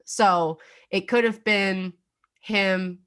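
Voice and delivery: normal talking voice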